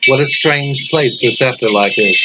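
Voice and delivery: usual speaking voice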